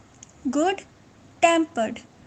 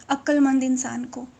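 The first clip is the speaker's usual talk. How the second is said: usual speaking voice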